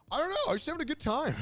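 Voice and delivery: high, fast, giggling through the words; shrugging it off with a laugh; laughing carefree. Retold or imitated. Dancer explaining why he hid his English, laughing lightly. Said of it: "He was like".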